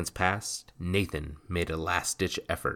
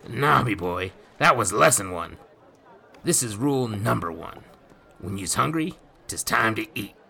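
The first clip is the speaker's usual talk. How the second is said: lightly voiced gruff pirate, a touch louder; rough pirate voice; 5 semitones higher, 6 dB louder than usual. A narrator's reading. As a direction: speak like a swaggering old sea rogue. Nathan voiced.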